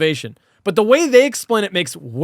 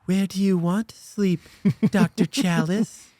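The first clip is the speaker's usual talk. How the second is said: mock seductive Ellie; 4 dB quieter than usual. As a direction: imitate a coy movie seductress. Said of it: "Ellie's like".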